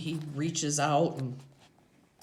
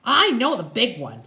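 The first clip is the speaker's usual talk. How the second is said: higher, louder woman's character voice; raised, insistent character voice; squinty Loretta; 9 semitones higher, 10 dB louder than usual. A narrator's reading. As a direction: voice the biker's woman, pitched up.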